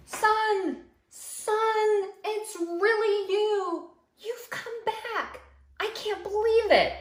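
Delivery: high, excited dad voice calling out; overjoyed father. Acted. Father running to his returning son, high joyful cries. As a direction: shout for joy like a father seeing his lost son.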